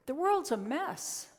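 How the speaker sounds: high, slow lament; acted dismay. Voicing an overwhelmed listener's thought.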